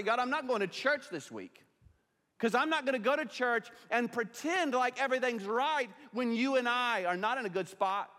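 high, loud preacher's voice voicing Hannah's anger; preaching at full force. Pastor preaching to the congregation about Hannah, full force. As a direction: preach it out, raising the voice over the room.

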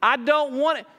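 high, loud, desperate plea; pleading Pilate. Retold or imitated. Preacher voicing Pilate begging Jesus, loud and pleading. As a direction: beg as a frightened governor would. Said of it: "He's saying".

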